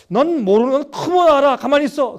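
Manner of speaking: loud, high fatherly snap; father silencing a complaining child; scolding father. Acted. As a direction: shut the whining kid down.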